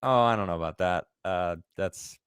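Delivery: usual speaking voice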